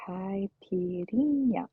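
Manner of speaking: "Caipirinha" slowly, kai-pee-REEN-ya; careful pronunciation. Shown as a demonstration. Streamer reading a viewer's written-out pronunciation, slow "Caipirinha" stressed on "rin". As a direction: stretch "Caipirinha" out, leaning on the "rin". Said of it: "in a way that I can say".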